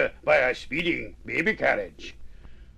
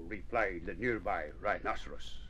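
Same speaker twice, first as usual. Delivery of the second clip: plain reading voice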